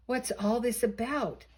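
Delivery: plain reading voice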